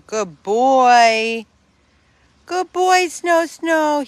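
high, loud, drawn-out praise; gushing pet praise. Real, not acted. Praising an old dog.

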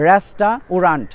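রেস্টা উরান্ট said slowly, as spelt; slow spelled-out রেস্টা উরান্ট; spelling pronunciation. Shown as a demonstration. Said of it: "তাহলে বলতে হবে". (ধীরে বানান-উচ্চারণ: রেস্টা উরান্ট)

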